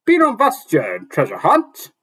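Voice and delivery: high, loud dwarf voice; dwarf exclaiming. Acted. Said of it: "the dwarf exclaimed".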